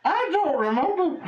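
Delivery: high-pitched comic bafflement; mock-helpless high voice; flustered protest. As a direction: squeak it out, helplessly confused. Player flustered about supposed wife.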